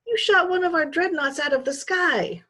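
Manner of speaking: high, loud furious accusation. A narrator's reading. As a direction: hurl it at him in rage. Lyle enraged at Jed.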